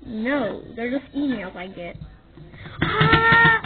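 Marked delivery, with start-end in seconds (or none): 2.8-3.6 excited scream. Acted. Fan screams at notifications.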